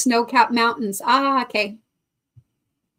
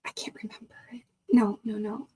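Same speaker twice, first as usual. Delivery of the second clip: whisper; 3 semitones lower, 8 dB quieter than usual. Real, not acted. Embarrassed, forgot a viewer's name.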